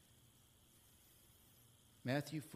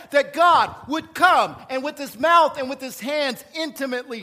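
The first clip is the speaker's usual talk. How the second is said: loud, fervent sermon delivery; impassioned preaching; 7 semitones higher, 10 dB louder than usual. Real, not acted. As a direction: preach it with full force. Preaching at full force.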